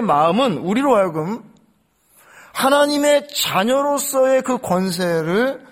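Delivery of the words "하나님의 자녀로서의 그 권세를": slow and higher, stretching each word; slow emphasis. Acted. Preacher impressing identity on congregation, slow and higher. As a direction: draw out 하나님의 자녀로서의 for weight. Preacher stresses key phrase.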